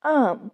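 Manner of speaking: आर्म said "aam", with no R sound; silent R. Shown as a demonstration. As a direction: drop the R in आर्म, leaving a long "aa". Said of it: "आर जो है वो silent होगा". (आर्म में आर silent, "आम" जैसा)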